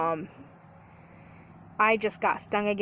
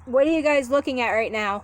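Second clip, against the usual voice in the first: calling out; 5 semitones higher, 8 dB louder than usual. Real, not acted. Calling out to beekeepers afar.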